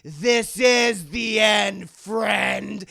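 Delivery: loud, drawn-out proclamation; declaimed payoff line. Acted. Andy dooms the doll, loud slow declaration. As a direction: stretch each word out loudly. Andy's line before lighting match.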